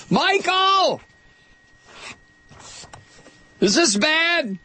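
high-pitched call across the studio. Real, not acted. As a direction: call out to the producer. Calling out to producer.

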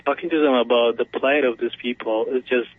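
ordinary talk

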